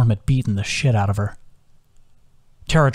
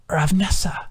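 hoarse, pained whisper; sandpaper voice; 6 semitones higher than usual. A narrator's reading. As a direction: whisper it through a raw throat, as if speaking hurts. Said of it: "Her voice was like sandpaper".

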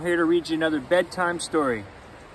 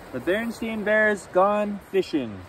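lively bedtime read-aloud; animated picture book reading; story narration; 3 dB louder than usual. A narrator's reading.

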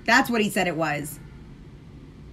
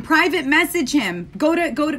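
ordinary talk, nothing marked

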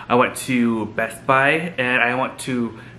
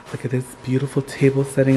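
usual speaking voice

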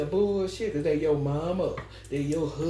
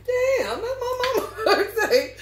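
high, louder, laughing amazement; amazed laugh; 4 dB louder than usual. Retold or imitated. Realizing the shared birthday, a high laughing amazed squeal. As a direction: squeal it like you can't believe it. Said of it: "I'm like".

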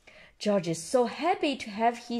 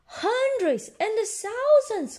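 put-on high, drawn-out child's exclamation; high-pitched slow wonder; excited cry; 13 semitones higher, slower than usual. A narrator's reading. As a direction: cry it out slowly as amazed little Peppa. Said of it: "cries Peppa".

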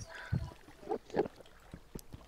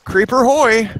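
loud, higher lookout's call; raised-voice playful alert; 6 semitones higher, 5 dB louder, slower than usual. Pretend. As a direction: sing out a warning like a sailor. Calling out a creeper sighting.

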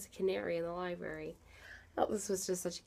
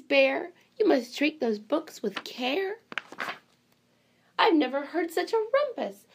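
high, bright-eyed librarian voice, louder throughout; storybook librarian welcoming animals; fussy librarian; 7 semitones higher, 8 dB louder than usual. Acted. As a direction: play the flustered, delighted librarian. Librarian voice reading picture book.

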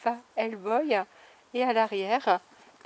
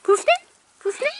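puppy talk; 7 semitones higher, faster than usual. Real, not acted. Greeting puppies in pet voice.

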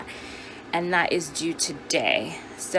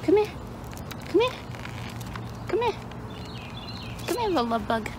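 tender coo; 12 semitones higher, 6 dB quieter than usual. Real, not acted. Cooing to dog.

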